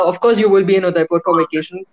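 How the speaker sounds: ordinary delivery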